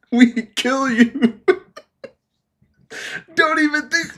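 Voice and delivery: high-pitched joke threat through giggles; laughing mock threat. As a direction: play the threat, cracking up.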